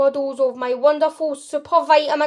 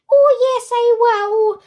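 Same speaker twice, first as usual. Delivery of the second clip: much higher, louder squeaky protest; tiny squeak; 10 semitones higher, 8 dB louder, slower than usual. Acted. Shrunken boy squeaks back at his mother, high and defiant. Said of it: "squeaked the tiny voice".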